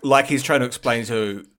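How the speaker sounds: ordinary talk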